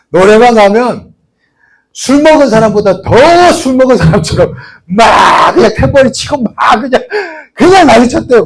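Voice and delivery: loud, excited retelling of wild karaoke; wild partying. Retold or imitated. Preacher retelling a coworker's karaoke antics, loud and excited. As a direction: shout it like a man going wild with a tambourine.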